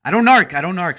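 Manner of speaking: high, loud burst easing off; emphatic refusal. Real, not acted. Streamer refusing to tell on his friends, protesting loudly. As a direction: blurt it out loudly, then ease down.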